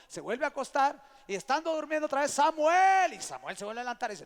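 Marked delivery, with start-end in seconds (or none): 2.3-3.1 high, booming shout of a name; loud call. Acted. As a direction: shout to wake the boy. Calling the sleeping boy again.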